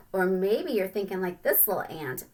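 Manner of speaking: ordinary talk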